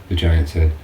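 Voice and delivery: usual speaking voice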